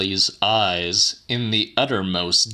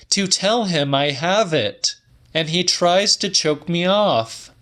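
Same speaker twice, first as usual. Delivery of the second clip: voiced much higher as the woman; Celia's voice; 9 semitones higher than usual. A narrator's reading. Woman telling the old man her news, higher voice. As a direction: lift the pitch well up for her lines.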